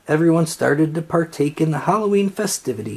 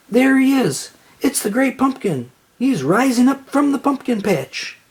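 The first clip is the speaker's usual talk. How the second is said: much higher, breathless joy; overwhelmed excitement; 9 semitones higher than usual. A narrator's reading. Linus sighting the great pumpkin, thrilled high cry. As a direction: burst with excitement. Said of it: "overwhelmed with excitement".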